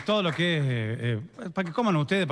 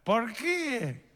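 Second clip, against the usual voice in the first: put-on higher, drawn-out old man's voice; old father-in-law; 5 semitones higher, 3 dB quieter than usual. Father-in-law addressing his daughter-in-law, old man's voice. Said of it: "Le dice el suegro".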